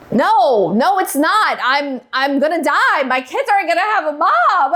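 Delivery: high-pitched, emotional re-enacted pleading; retold panicked protest. Wife contradicting husband's migraine diagnosis, panicked and near tears. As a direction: replay the desperate denial, pitch climbing. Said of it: "I was crying, I'd saying stuff like".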